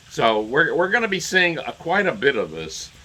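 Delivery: ordinary delivery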